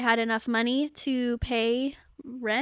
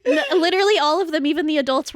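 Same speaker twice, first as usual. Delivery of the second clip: excited; 4 semitones higher, 7 dB louder, faster than usual. Real, not acted. Excited outburst, loud.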